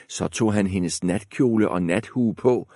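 ordinary delivery, nothing marked

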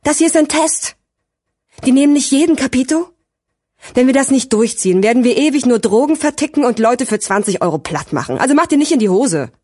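high-pitched, agitated scolding; heated rebuke. A narrator's reading. Gigi warns his hesitant friend about the test, heated. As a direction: lay down the stakes impatiently. Gigi's angry rebuke goes on.

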